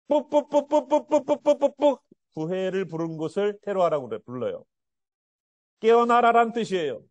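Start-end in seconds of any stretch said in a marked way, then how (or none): 0.1-2.0 short clipped toots imitating a trumpet; staccato trumpet sound. Shown as a demonstration. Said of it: "이것은 스타카토처럼".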